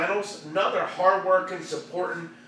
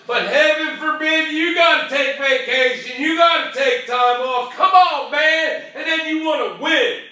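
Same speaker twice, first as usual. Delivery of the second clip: high, loud, indignant tirade; exasperated rant; 8 semitones higher, 7 dB louder than usual. Real, not acted. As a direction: rail at their excuses, voice raised. Rant at people making excuses.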